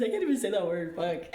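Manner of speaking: loud, laughing exasperation at a tongue-twister; real laughing frustration at fumbling a word. Real, not acted. Laughs at own failed pronunciation.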